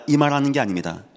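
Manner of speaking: normal talking voice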